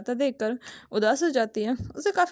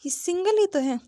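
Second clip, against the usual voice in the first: angry retort; 7 semitones higher than usual. A narrator's reading. Shang Shang to the omega, an irritated higher snap. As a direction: snap back in annoyance at the omega. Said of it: "गुस्से से कहती है".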